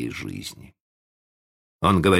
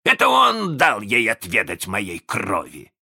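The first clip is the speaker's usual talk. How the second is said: offended roar; 5 semitones higher, 3 dB louder than usual. A narrator's reading. Hook demands Peter Pan, roaring with grievance. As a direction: cry out for the enemy who wronged you. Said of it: "рявкнул с обидой Крюк".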